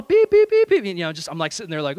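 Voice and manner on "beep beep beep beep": very high, loud beeps; imitated bleeps. Pastor overhears gangster's phone call, imitated bleeps. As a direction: mimic censor bleeps in a shrieky pitch.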